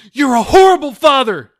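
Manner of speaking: high, loud scream. A narrator's reading. Daughter screams at her father, high and loud. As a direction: tear into him. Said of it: "she screamed".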